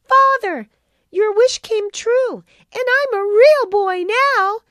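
put-on high, loud, chirpy puppet boy; little wooden voice. A narrator's reading. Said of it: "he chirped in his little wooden voice".

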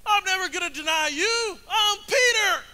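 loud, high emphatic declaration; over-the-top bold protest; boastful vow. Acted. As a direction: proclaim it proudly at a shout. Acts out Peter's boastful vow.

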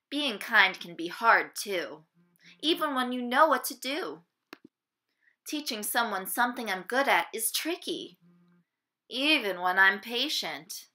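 ordinary delivery

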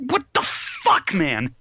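high, drawn-out incredulous cry; baffled outburst. Retold or imitated. Reviewer reliving a bonkers sheep episode, baffled outburst. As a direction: exclaim it in high-pitched disbelief. Acting out own baffled reaction.

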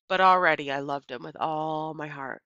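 plain reading voice

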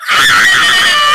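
very high-pitched scream; acted scream. Crying out for help in a skit, scream. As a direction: let out a piercing, high mock scream.

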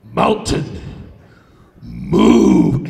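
very deep, booming incantation; magic command. Acted. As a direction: command the mountain in a huge low voice. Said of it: "And he goes like this".

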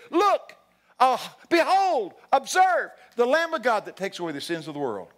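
high, loud herald's cry, easing at the end; exclaimed proclamation. Retold or imitated. John the Baptist announcing Jesus, loud proclamation. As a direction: call out for everyone to look.

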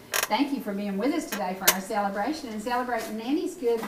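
ordinary talk, nothing marked

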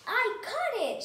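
high, loud triumphant yell. A narrator's reading. Amelia Bedelia grabbing the fish, yelled. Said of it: "she yelled".

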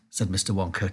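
ordinary delivery

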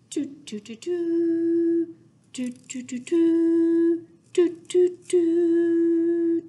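put-on playful horn toots for kids; horn imitation. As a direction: blow the words like a toy horn. Imitating Blue Bear's horn.

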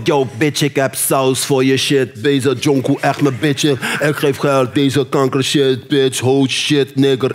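mock Nederhop rap; Dutch rapper. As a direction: mimic a Dutch hip-hop track. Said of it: "gaat dan ook zo van".

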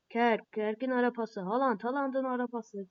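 ordinary delivery

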